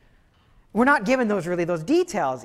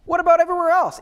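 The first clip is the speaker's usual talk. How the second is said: much higher, offhand, rising louder at the end; nonchalant king; 10 semitones higher, 4 dB louder than usual. Retold or imitated. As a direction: breeze past the deaths. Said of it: "he seems very nonchalant".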